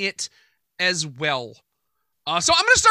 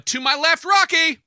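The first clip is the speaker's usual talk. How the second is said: raised, upbeat name announcement; upbeat introduction; 7 semitones higher, 4 dB louder than usual. Real, not acted. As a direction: introduce a co-host with high energy. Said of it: "I was very upbeat".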